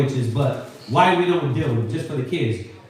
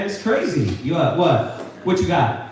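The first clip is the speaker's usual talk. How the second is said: usual speaking voice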